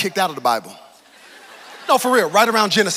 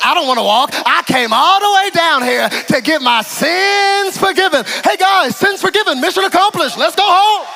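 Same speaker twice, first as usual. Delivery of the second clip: loud, high mock gratitude; sarcastic thanks; 8 semitones higher, 7 dB louder than usual. Paralysed man sarcastically thanks Jesus, loud and high. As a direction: drip sarcasm, loud and laughing.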